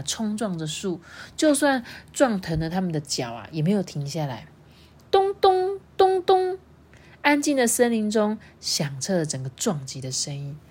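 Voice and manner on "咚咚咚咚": high, slow imitated thuds; mock thumping. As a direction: bang out the knocks. Kids bang into the tree.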